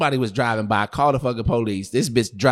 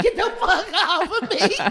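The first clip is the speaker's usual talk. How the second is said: high, slow protest through laughter; mock panicked yell; slower than usual. Storyteller replaying himself pinned by his girlfriend, high mock yell. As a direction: laughingly act out fending someone off. Retold struggle in the car.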